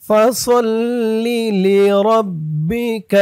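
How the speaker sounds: slow melodic tajwid chant; measured Quran recitation; Quranic recitation. Shown as a demonstration. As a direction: recite slowly with a drawn-out melody. Recites verse slowly.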